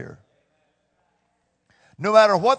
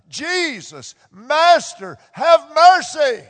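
loud, slow plea for mercy, higher; pleading cry; 5 semitones higher, 6 dB louder, slower than usual. Retold or imitated. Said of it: "They lifted up their voices".

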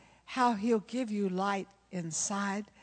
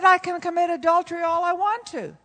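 higher, louder, teasing comeback; cheeky retort; 8 semitones higher, 9 dB louder than usual. Retold or imitated. Pastor's wife teasing a stranger on a plane, cheeky and loud. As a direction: toss it back at him with a grin.

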